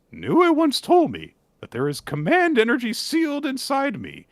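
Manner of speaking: high falsetto, a little cheeky; Gakuro's voice. Acted. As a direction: tease her in the boyish voice. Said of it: "And Gakuro says".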